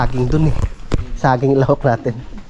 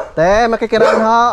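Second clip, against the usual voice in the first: high polite call across; calling from far away; 7 semitones higher than usual. Real, not acted. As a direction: call out asking to pass. Calling for passage. (pagtawag mula sa malayo)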